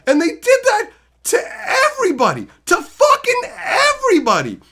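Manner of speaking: high, loud, cracking with indignation; outraged shout. Real, not acted. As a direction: still furious about it. Still outraged retelling.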